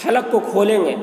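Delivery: ordinary delivery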